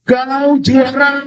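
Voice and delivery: high, stretched-out long-distance call; storytelling shout. A narrator's reading. Father shouting again to the mountain, drawn-out shout. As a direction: call it out slowly on a high note. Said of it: "Ayahnya berteriak lagi".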